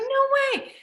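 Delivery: very high, loud retold squeal; thrilled disbelief. Filmmaker told the agent will help, thrilled disbelief. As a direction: imitate an excited, high-pitched reaction.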